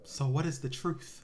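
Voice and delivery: hushed, slow mock wonder; slow, quiet pretend bafflement; mock mystery. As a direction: ponder it softly as if facing a great mystery. Mock puzzlement at conflicting reviews.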